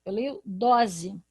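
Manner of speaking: dose with a z sound, drawn out slowly; reading as dose. Shown as a demonstration. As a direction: stretch dose slowly with a buzzing z. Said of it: "aqui eu não leio doce".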